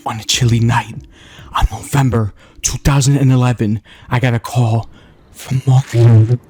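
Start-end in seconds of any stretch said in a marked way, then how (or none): 5.3-6.4 excited. Acted. Excited about Mario calling.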